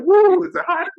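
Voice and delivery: put-on nervous sweating kid. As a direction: squeak it out like a kid sweating bullets.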